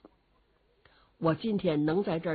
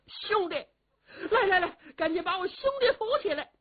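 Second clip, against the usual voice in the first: high, fast, crying orders; tearful urgent call; 11 semitones higher, faster than usual. Acted. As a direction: sob while hurrying the servants. 罗焕 weeps, calls servants.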